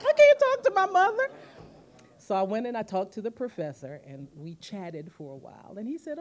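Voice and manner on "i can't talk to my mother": hammed-up loud, high protest; comic high-pitched panicky wail; panicked wail. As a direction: re-enact dread at full pitch. Retold panic about telling mother.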